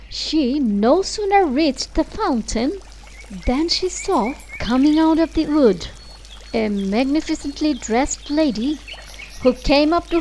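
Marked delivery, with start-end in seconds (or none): none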